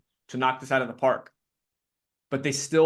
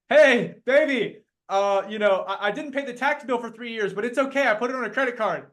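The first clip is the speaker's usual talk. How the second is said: sheepish confession; 6 semitones higher than usual. Retold or imitated. Spouse confessing unpaid taxes.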